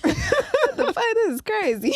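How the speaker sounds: high, loud laughter breaking through the words; cracking up as you say it; laughing in disbelief. Real, not acted. Laughing about his friend's lucky night, loud high disbelief.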